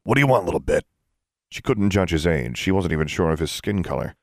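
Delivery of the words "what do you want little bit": gruff shopkeeper's demand; gruff, dismissive question. A narrator's reading. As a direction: challenge a kid in a gruff character voice. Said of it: "the man asked gruffly".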